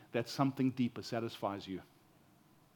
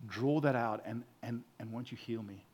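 much softer, hushed surrender; quiet plea; 3 semitones lower, 7 dB quieter than usual. Retold or imitated. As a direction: pray it quietly, giving in. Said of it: "We say".

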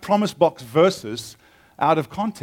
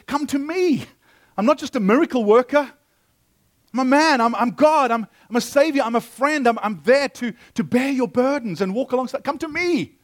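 impassioned plea; 7 semitones higher than usual. Acted. Jesus calling to the cities that took his gift, impassioned. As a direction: plead with them to come.

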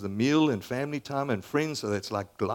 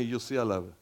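ordinary talk, nothing marked

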